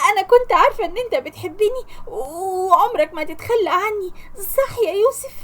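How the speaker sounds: high, unhinged laugh-crying through the words; crazed laughing. Acted. Lubna to Youssef, deluded he came to marry her, crazed laughing. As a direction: laugh like a madwoman clinging to him. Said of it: "بتضحك زي المجانين وبتقول".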